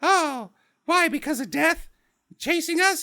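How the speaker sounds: high, rising, playing dumb; mock naive girl. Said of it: "She's like".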